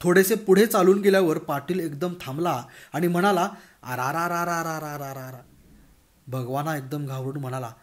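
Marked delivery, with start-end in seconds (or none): 3.8-5.4 drawn-out exclamation. Acted. Patil stopping short on the road, a long slow groan. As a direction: let out a long rolling "arara".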